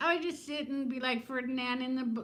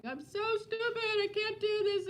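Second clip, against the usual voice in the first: much higher, tearful self-mocking wail; imitating her crying self; 12 semitones higher than usual. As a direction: replay the breakdown in a high, tearful voice.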